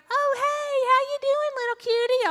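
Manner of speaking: high, loud, sugary imitation; gushing baby-talk greeting. Meth heads greeting her little child, mock-gushing.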